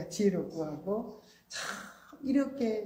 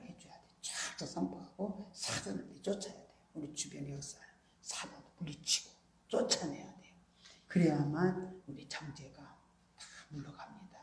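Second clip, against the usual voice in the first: hushed whisper; 4 semitones lower, 11 dB quieter than usual. Real, not acted. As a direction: drop to an urgent whisper. Sudden whisper in sermon.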